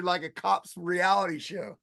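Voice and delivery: usual speaking voice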